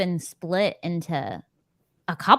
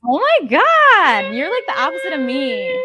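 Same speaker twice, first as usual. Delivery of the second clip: stunned surprise; 13 semitones higher, 7 dB louder than usual. Real, not acted. Reacting to opposite book ratings.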